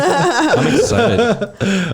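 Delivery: laughing through the words; drawn out laughing "I'm excited". Real, not acted.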